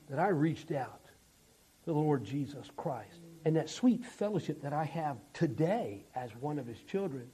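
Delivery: low, soft sermon voice with pauses; hushed, earnest pulpit appeal; tender preaching. Real, not acted. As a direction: speak quietly, as personal testimony. Preacher gives soft personal testimony.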